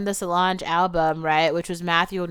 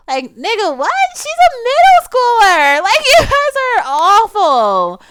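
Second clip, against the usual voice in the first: incredulous shriek; 7 dB louder than usual. Real, not acted. Vlogger scolding the men in the film, high incredulous shriek. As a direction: shriek it in horrified disbelief.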